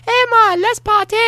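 hammed-up falsetto invite; mock falsetto partier. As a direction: squeak it out like a flirty partygoer. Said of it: "was all like".

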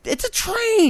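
very high, incredulous squeal; exasperated disbelief. Real, not acted. Host baffled that people get hit by trains, exasperated disbelief. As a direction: pitch shoots up in disbelief.

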